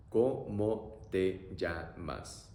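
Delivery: very slow ¿Cómo te llamas? each syllable stretched; teacher modelling slowly; slow demo. Shown as a demonstration. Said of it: "So, pronunciation".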